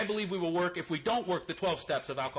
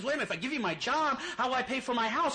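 put-on drunk pleading; 9 semitones higher than usual. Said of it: "He says".

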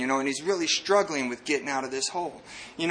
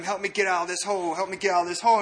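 ordinary delivery

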